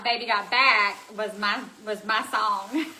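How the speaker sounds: ordinary delivery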